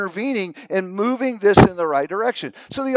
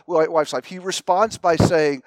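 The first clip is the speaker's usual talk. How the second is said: usual speaking voice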